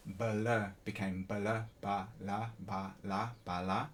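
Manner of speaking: "blur" broken into "ba, la", slow; slow "ba, la, ba, la" splitting the cluster; blur split apart. Shown as a demonstration. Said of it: "like that mechanism I mentioned".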